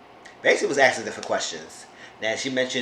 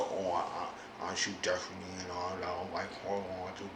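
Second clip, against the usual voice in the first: much lower and softer, mumbling through a heavy mouth; heavy-mouthed Sean; 5 semitones lower, 15 dB quieter than usual. Retold or imitated. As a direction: imitate Sean's thick, lazy mouth. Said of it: "he talk with his mouth like, like his heavy mouth".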